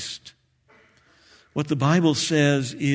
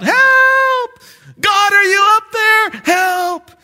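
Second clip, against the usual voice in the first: much higher, shouting in panic from a cliff; desperate cry for help; 7 dB louder, much slower than usual. Acted. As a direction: scream up to the sky for rescue. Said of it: "he cries out".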